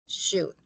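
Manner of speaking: SHOULD as one syllable, "shood", no L; should with silent L. Shown as a demonstration. Teacher repeats "should" for the class to copy, silent L. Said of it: "yo no pronuncio la L".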